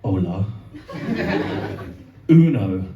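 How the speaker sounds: slow, self-assured tourist Spanish; confident mum. Retold or imitated. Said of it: "with all the confidence in the world".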